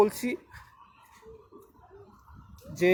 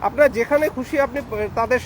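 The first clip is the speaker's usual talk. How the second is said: raised voice; 4 semitones higher, 4 dB louder than usual. Real, not acted. Urgent appeal for unity to followers.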